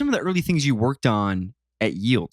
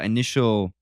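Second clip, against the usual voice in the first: ordinary talk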